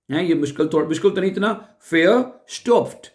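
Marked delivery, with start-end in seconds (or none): 1.9-3.1 verstopft with a clear stopp sound in the middle and ft at the end; stop sound shown. Shown as a demonstration. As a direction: verstopft higher, stopp in the middle made plain. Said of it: "स्टॉप नहीं कहते".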